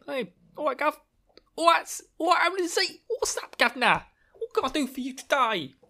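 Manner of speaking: high, cocky mock greeting; Rand as cheeky chap. Rand greeting the Amyrlin, high cocky mock voice. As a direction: play it like a brash lad greeting the boss. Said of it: "kneel and say".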